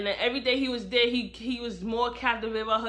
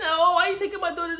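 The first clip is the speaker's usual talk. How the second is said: higher, fast, laughing protest; mock whiny mother; 6 semitones higher, 4 dB louder, much faster than usual. Narrator mocking Desi protesting her daughter's removal, fast whiny. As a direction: whine it up as a mocking imitation. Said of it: "Desi, like".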